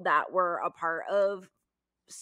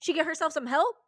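sarcastic disbelief; 6 semitones higher than usual. Retold or imitated. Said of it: "I'm like".

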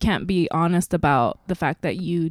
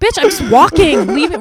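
loud, high, indignant comeback; exasperated retort; 8 semitones higher, 10 dB louder than usual. Retold or imitated. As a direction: fire back at the critics in mock outrage. Acted exasperated retort to the critics.

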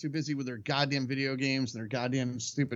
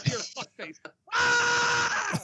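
mock war face yell; much slower than usual. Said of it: "show me your war face".

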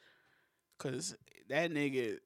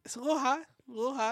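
sing-song teasing; 9 semitones higher, faster than usual. Real, not acted. Mocking sing-song repeat.

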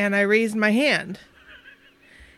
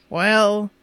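ordinary talk, nothing marked

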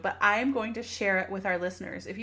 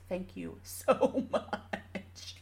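choked-up laugh; 4 dB quieter, much slower than usual. Real, not acted. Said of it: "I'm getting emotional".

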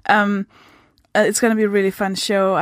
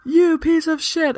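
higher, slow mock insult; play-acted outrage, pitched up and stretched; imagined angry outburst; 7 semitones higher, slower than usual. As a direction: draw out a mock-furious insult. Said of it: "everyone's expecting her to be like".

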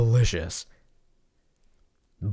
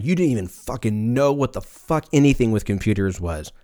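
usual speaking voice

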